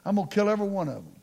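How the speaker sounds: normal talking voice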